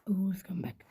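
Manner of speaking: unvoiced whisper of the catchphrase; whispered echo. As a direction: breathe the line out like a secret. (fısıltıyla tekrar)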